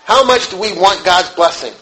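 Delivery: ordinary talk, nothing marked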